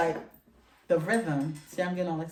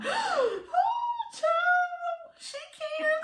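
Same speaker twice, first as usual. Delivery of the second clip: tiny, very high squeal; mock swooning reply; much slower than usual. Woman retelling her answer to her lover's challenge, squealing. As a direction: squeak it out, drawn out and hammed-up.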